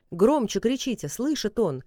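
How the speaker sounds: commanding shout. Acted. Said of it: "приказала Егиня".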